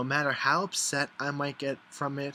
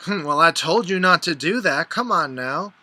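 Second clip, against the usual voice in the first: loud, higher-pitched mock scolding; I-told-you-so friend; 6 semitones higher, 11 dB louder than usual. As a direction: imitate a know-it-all friend telling you off, loudly. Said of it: "We all have that one friend".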